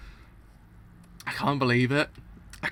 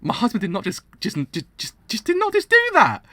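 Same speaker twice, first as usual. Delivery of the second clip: high shocked disbelief; stunned squeal of disbelief; shocked outburst; 9 semitones higher, 5 dB louder than usual. Real, not acted. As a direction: sputter in outrage at the betrayal. Shocked reaction to betrayal.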